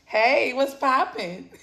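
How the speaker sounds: slow, flirty mock New York accent; playful drawn-out New York accent; imitated New York accent. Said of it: "I love the New York accent".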